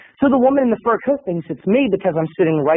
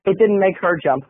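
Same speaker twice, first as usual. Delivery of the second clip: ordinary talk, nothing marked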